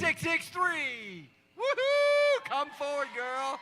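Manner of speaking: loud, high, drawn-out winner call; booming prize announcer. Real, not acted. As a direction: boom it out like a game show host.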